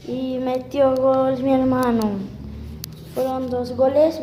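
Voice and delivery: loud, drawn-out goal call; goal commentary. Pretend. Calling his brother's goal.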